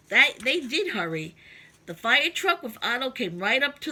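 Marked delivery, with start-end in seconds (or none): none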